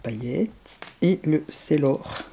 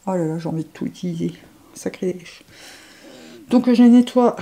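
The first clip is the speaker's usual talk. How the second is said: ordinary talk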